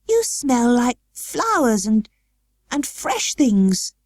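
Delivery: put-on joyful sick boy, slow and lifted; joyous. A narrator's reading. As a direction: breathe in the flowers with joy. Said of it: "he cried out quite joyously".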